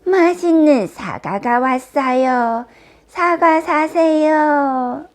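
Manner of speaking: slow, loud apple-seller's cry; drawn-out singsong peddler shout; vendor calling out. A narrator's reading. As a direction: hawk apples in long, high calls. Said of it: "왕비는 난쟁이들의 집 앞에서 외쳤어요".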